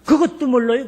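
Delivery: mild imitation of a know-it-all son; child talking back. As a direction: lecture dad like a smart-aleck kid. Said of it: "내가 어린 소견에 말이요".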